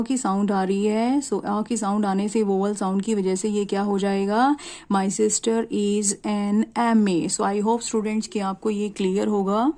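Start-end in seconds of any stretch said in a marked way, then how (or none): 4.9-7.4 slow model reading. Shown as a demonstration. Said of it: "reads answer slowly".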